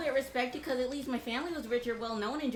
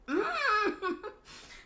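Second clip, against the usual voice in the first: bitter laugh; 11 semitones higher, 4 dB louder than usual. Real, not acted. Bitter laugh mid rant.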